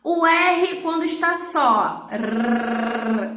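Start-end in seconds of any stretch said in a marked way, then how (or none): 2.1-3.3 tongue-tip trilled R, held long. Shown as a demonstration. Teacher demonstrates the single R, trilled. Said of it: "a língua treme lá na ponta do dente".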